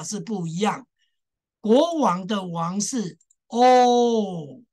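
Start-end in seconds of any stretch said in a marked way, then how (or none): 1.6-4.6 slow, joined O sounds; slow long O demo. Shown as a demonstration. Teacher linking the vowels slowly for students. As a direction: say O，O slowly, linked into one long O. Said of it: "连在一起的".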